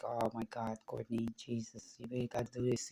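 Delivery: low, very soft mumbled aside; quietly voiced, subdued rock singer. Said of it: "[name] sitting like".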